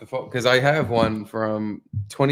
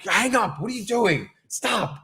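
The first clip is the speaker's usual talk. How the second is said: higher, urgent pleading; mock alarmed partner; 9 semitones higher than usual. Partner urging him to hang up, mildly mock alarmed. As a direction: imitate a worried partner telling you to stop. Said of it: "Caitlin's like".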